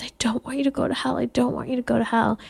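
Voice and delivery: put-on hushed, hurried half-whisper of a child; soft, quick imitation of an upset child; imitating weeping child. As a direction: whisper it as a little girl pleading at bedtime. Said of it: "She is weeping because she doesn't want".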